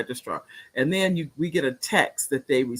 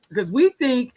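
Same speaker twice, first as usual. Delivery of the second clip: ordinary delivery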